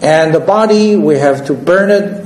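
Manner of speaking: ordinary talk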